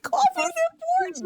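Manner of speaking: squeaky high giggling line; can barely get it out for laughing. Real, not acted. Telling the host the story, cracking up. Laughing while retelling the encounter.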